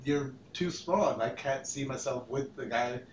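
soft and low, serious rejection; dead serious. Retold or imitated. Girlfriend turning down his proposal, dead serious. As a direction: deliver it quietly, in all seriousness. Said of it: "she gets dead serious".

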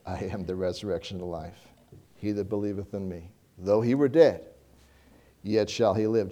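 low and very soft words of Christ; hushed scripture. Acted. Preacher quoting Jesus to Martha, hushed and low. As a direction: drop almost to a hush, reverent.

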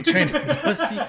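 high laughing. Real, not acted. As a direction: laugh out the words. Laughing repetition.

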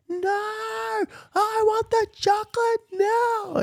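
put-on high, slow, drawn-out child's wail; toddler screaming for chocolate. Dad imitating his son refusing dinner, a high slow childish wail. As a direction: whine it out like a toddler demanding a treat.

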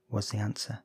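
ordinary delivery, nothing marked

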